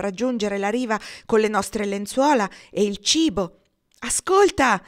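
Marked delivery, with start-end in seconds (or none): 4.0-4.8 sharp, high, loud call; shouted command. A narrator's reading. Girl urging the narrator to listen, high loud shout. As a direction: cry out to be heard over the waves. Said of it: "gridò la ragazza".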